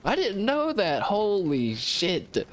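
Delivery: shocked, high-pitched disbelief; surprised. Retold or imitated. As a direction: jolt up in pitch, then drag it out. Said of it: "And I was like".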